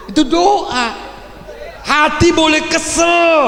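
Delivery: high, loud and slow; preaching shout. Real, not acted. Preacher warning parents, high loud slow shout. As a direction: hammer the point home.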